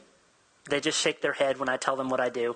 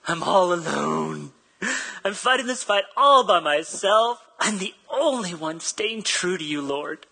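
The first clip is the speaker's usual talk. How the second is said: mock sob story; 2 semitones higher, 4 dB louder, slower than usual. Pastor mocking Elijah's complaint to God, hammed-up whining. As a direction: ham up the poor-me moan. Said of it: "really sad sob story".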